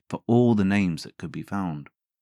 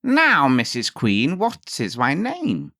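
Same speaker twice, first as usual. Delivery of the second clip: high, slow, loud gloating imp; smug little man; 7 semitones higher, 4 dB louder, slower than usual. A narrator's reading. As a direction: taunt the queen slowly. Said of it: "walked in and said".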